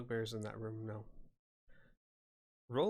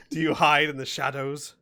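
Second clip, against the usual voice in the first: very loud, higher-pitched monster taunt; booming hobgoblin; 5 semitones higher, 12 dB louder than usual. Acted. Hobgoblin taunting a hidden intruder, loud and booming. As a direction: shout it as a hobgoblin challenge. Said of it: "you hear in a loud, booming voice".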